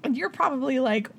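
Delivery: ordinary talk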